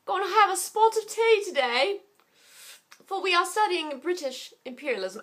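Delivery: high, put-on bad British accent; hammy fake British teacher voice. Teacher hamming up a British accent for the class. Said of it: "my British accent is terrible today".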